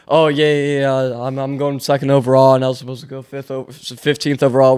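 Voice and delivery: low, offhand mock-casual voice; nonchalant. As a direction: act like being drafted is no big deal. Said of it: "acting nonchalant".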